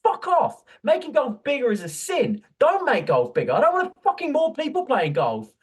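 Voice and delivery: high, loud mocking tirade; sarcastic rant. Real, not acted. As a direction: pour scorn on it at full pitch. Sarcastic rant against golf.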